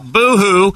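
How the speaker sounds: high, slow mock boo-hoo; fake crying. Mocking co-host as wet blanket.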